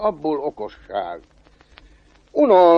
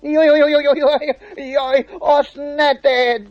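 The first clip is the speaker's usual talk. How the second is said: high, panicked wailing; pleading devil; 11 semitones higher, slower than usual. Acted. Devil begging the cobbler not to take the well, panicked wail. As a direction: yelp rapidly, then beg in a very high voice. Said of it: "rimánkodott az ördög".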